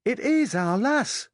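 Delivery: put-on high child's Yorkshire voice with a long, stressed "is"; little Yorkshire lad insisting, "is" drawn out; insistent Yorkshire boy. A narrator's reading. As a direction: insist "It is our lass" slowly, leaning hard on "is". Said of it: "it's common".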